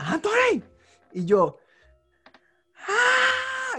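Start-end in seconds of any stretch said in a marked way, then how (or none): 0.1-0.6 high, sharp shout of a name; scene: mom suddenly yells her son's name at night, a high shout; mom's shout. Retold or imitated. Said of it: "mami grita". 2.9-3.8 put-on high shrieking scream of a scared boy; child screaming awake at night, high shriek; terrified kid scream. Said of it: "Y empecé a gritar bien duro".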